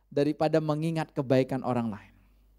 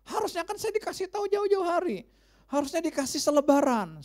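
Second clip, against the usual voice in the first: angry neighbour; 11 semitones higher than usual. Retold or imitated. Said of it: "Dia marah-marah".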